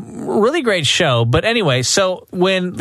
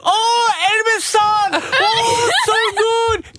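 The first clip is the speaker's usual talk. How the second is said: much higher, slow, cheering fan voice; mock Japanese fans; much slower than usual. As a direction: cheer like starstruck fans at the show. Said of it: "They're like".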